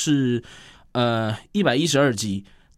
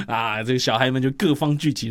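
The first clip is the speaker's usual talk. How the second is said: ordinary talk, nothing marked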